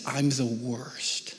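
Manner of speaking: slow, soft, downcast; a touch of a child's sob; tearful child. Retold or imitated. Daughter saying she is the worst, softly tearful. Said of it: "And she's crying".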